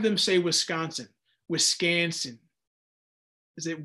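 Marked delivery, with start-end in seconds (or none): none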